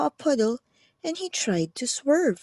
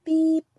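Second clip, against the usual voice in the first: high, bright little horn beep; truck beep; 5 semitones higher, 4 dB louder than usual. A narrator's reading. Little truck greeting a toad, bright beep. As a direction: toot like a friendly horn. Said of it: "said Blue".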